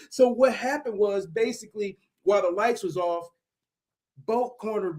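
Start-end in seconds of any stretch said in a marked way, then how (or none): none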